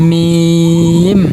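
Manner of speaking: drawn-out, chanted মিম with a humming close; lips-together M. Shown as a demonstration. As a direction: close both lips on a ringing final m in মিম. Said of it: "দুই ঠোঁট মিশিয়ে".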